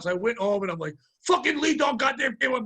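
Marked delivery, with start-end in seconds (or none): 1.3-2.6 higher-pitched indignant outburst; worked-up complaint, pitched up; retold angry yelling. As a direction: re-enact yelling in outrage at home. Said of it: "I'm yelling, I'm like".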